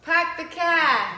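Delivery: slow, loud Boston "Pack the cab" with no r; Boston accent. Retold or imitated. Trying the local phrase for her co-host, slow loud Boston accent. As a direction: stretch it out like a Bostonian. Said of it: "What else do you guys say?".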